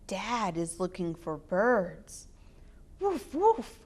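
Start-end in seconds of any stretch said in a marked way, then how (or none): 3.0-3.8 puppy barks. Acted. Puppy Biscuit barking at birds.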